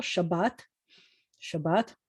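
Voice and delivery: "Shabbat" stressed on its second syllable. Shown as a demonstration. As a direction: demonstrate "Shabbat" with weight on the last syllable. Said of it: "accents on the second syllable".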